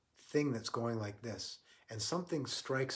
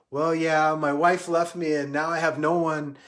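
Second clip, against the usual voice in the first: loud, high, wailed outburst; upset drunk guy; 4 semitones higher, 12 dB louder than usual. Retold or imitated. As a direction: pour out the grievance at full volume. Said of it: "the drunk guy goes".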